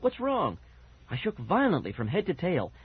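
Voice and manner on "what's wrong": high-pitched worried outcry; alarmed cry. A narrator's reading. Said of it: "Fergie cried out".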